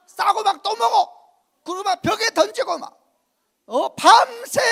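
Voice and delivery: loud, slow, preaching right at the people; a pastor telling the church about a filthy spirit, loud and preaching; preaching at full force. Acted. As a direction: build up the fire of the sermon. Preacher builds to shouting.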